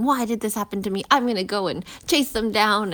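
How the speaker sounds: fast, heated rant; mock angry driver. Driver cut off in traffic, mock angry outburst. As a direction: blurt it like a furious driver who got cut off. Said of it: "getting really upset and angry and saying".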